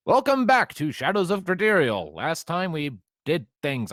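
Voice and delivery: loud, high show-intro voice; announcer voice. Pretend. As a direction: open like a show host. Opening the game session.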